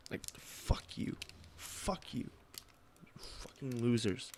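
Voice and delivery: real hushed, slow cursing; disgusted muttering. Real, not acted. Fed-up buyer cursing the card makers, disgusted mutter. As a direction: curse them softly under the breath.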